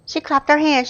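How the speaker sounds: usual speaking voice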